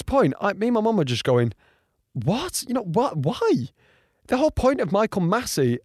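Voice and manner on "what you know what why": high-pitched, incredulous questioning; baffled disbelief. Retold or imitated. Fan and mum react to the decision, acted bafflement. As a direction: act out being utterly baffled.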